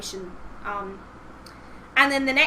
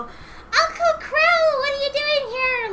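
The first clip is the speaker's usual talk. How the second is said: put-on much higher, slow sweet girlish voice; surprised girl voice; 5 dB louder, slower than usual. Niece surprised to see her uncle arrive, sweet high voice. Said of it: "this whole".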